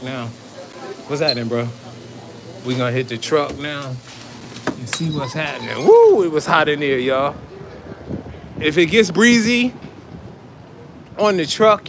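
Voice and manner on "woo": high, loud happy whoop; excited whoop. Real, not acted. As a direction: let out a loud joyful woo. Stepping out into fresh air.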